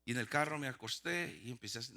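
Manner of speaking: ordinary talk, nothing marked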